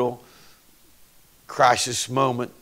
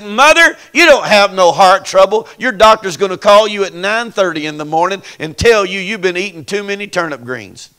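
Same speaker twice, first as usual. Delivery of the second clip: prophetic declaration; 6 semitones higher, 7 dB louder than usual. Retold or imitated. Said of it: "I said".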